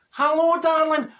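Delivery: high, loud put-on voice; hammed-up neighbour's voice; Fat Boy voice. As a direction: greet her in a silly high croon. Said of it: "what is Fat Boy's voice again? Oh, aye".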